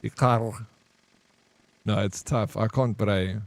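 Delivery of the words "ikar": Ikar with a guttural R in the throat; back of mouth R. Shown as a demonstration. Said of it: "You can do both".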